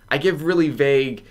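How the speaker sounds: ordinary talk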